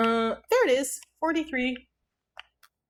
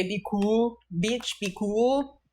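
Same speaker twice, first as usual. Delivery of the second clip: gas man voice; much slower than usual. Retold or imitated. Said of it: "When you hear him say".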